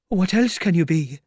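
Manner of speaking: high, loud retort; exasperated tramp. Acted. Tramp to what he thinks is imagination, exasperated. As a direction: argue back high and baffled.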